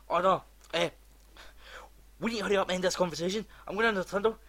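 loud, high, rushing. Retold or imitated. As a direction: gabble it out, speeding up. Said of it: "they just get faster and faster".

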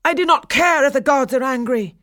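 high, loud defiant outburst; defiant cry. A narrator's reading. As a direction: fling it back at them. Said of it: "cried Daphnis".

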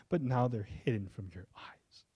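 soft grieving whisper; whispered lament. Retold or imitated. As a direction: whisper it as a lament over the city. Jesus weeping over Jerusalem.

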